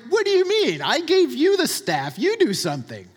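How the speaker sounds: exasperated God talking back, high and indignant at first; indignant mock-divine comeback; exasperated retort. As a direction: throw it back in disbelief, then hand it over firmly. God voiced as exasperated reply.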